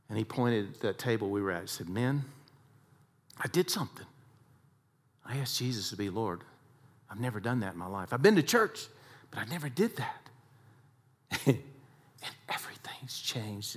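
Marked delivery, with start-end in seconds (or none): 1.9-10.3 hushed confession. Retold or imitated. Dick's quiet confession. 12.2-13.8 breathy, almost soundless; a hushed whisper as if moved. Real, not acted. Whispered emotional aside.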